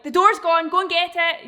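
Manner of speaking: high, loud frantic alarm; a dog going wild at the door; overexcited dog. Pretend. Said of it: "Not like".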